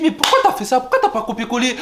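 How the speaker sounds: high, incredulous retold protest; exasperated disbelief. Nephew to uncle who sent money wrong, high exasperated protest.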